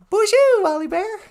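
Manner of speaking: high, loud sing-song hello; excited greeting. Real, not acted. Greeting Wally Bear.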